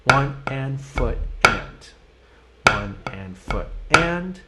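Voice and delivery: "One and foot and" spoken slowly, one word at a time; slow counting. Shown as a demonstration. Drum teacher counting slowly for a struggling student. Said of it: "play that accent after the right foot".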